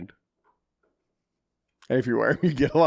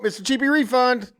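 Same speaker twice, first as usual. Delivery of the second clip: teasing; 9 semitones higher than usual. Real, not acted. Teasing a friend by nickname.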